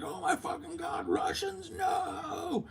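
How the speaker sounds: very high, terrified crying shout; panicked screaming. Retold or imitated. Drunk tourists hearing the alarm, screaming in terror. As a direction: scream in terror as the crowd flees.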